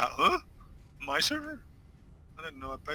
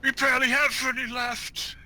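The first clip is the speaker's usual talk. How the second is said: put-on old-timer; 10 semitones higher than usual. Playing old-timers running out of time.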